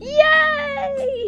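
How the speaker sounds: high, loud yay; excited cheer. Acted. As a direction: squeal out a delighted cheer. Cheering together in game.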